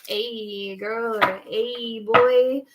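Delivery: hammed-up flirting, giggle creeping in; mock flirty. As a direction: imitate a smooth flirt, lingering on each greeting. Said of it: "really talk like that".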